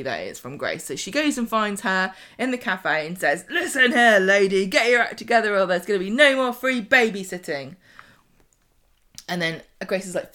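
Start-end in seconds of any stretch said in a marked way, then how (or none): 3.5-7.7 loud, stern scolding; retold dressing-down, much louder; angry telling-off. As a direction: lay down the law, full volume. Aggie confronts Grace.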